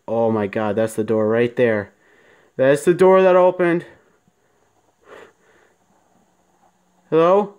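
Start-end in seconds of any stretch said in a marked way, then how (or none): 2.6-7.5 real fright, loud and higher; startled call into the dark; frightened. Real, not acted. Door opens in horror game.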